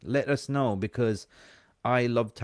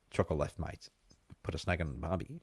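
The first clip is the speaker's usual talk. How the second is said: soft, lower Aussie twang; 4 semitones lower, 6 dB quieter, faster than usual. Shown as a demonstration. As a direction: let the Aussie twang come through. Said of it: "you can hear my Aussie twang".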